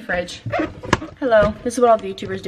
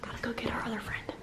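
whispering; 3 semitones higher, 10 dB quieter than usual. Real, not acted. Quiet at night.

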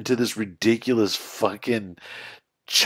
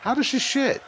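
lightly mimicking the comedian's high, incredulous delivery; a hint of George's disbelief, pitched up; echoing George; 12 semitones higher, 3 dB quieter than usual. Reacting to the clip, repeating George's line in mock disbelief. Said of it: "The way he says it".

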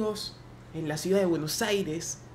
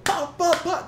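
drum beats; 9 semitones higher, slower than usual. Retold or imitated. Imitating the drum.

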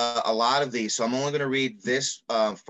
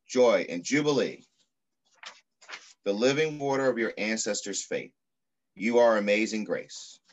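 put-on reading to children. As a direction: read it like an affirming poem to children. Reads picture book aloud.

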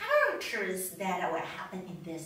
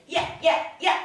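short, higher-pitched yaps; little dog yapping; 3 semitones higher, 4 dB louder, much faster than usual. Shown as a demonstration. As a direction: bark like a tiny dog. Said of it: "the little ones".

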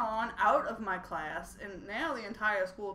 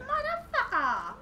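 very high, drawn-out swearing; slow, high-pitched outburst; exasperated curse; slower than usual. Real, not acted. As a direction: stretch it out in comic outrage. Cursing mid-story at the game.

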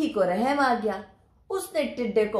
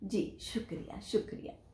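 soft thanks; 11 dB quieter than usual. Acted. Grasshopper thanks the bees.

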